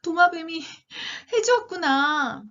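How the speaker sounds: high-pitched storybook wonder; amazed realization. Acted. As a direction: marvel at it, drawing out the end. Story figure realizes wish granted.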